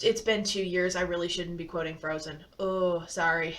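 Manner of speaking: ordinary delivery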